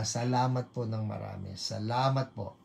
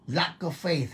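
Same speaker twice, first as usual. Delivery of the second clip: ordinary talk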